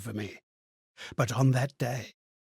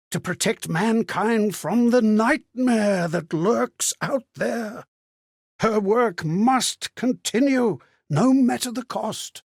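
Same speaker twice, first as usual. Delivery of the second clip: slow grave insistence that the work go on; solemn insistence; 4 semitones higher than usual. Acted. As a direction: lean on every word like a vow. Ghost insisting the work continue.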